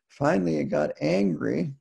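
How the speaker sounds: normal talking voice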